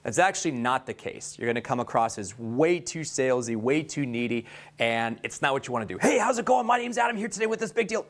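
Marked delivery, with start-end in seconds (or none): 6.0-8.1 much higher, pushy salesman energy; overly high energy. Shown as a demonstration. Sales trainer showing the wrong too-high way, hyped. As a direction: overdo the eager salesy pitch. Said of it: "you gotta go high energy the whole time".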